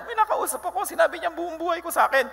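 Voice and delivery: high, laughing, breathless with news; woman at the well telling the townspeople, excitedly. Acted. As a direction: burst out laughing with excitement, racing at the end. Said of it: "sinabi niya sa mga taong bayan".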